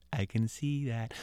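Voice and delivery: unimpressed. Acted. Boy answers the ghost dryly.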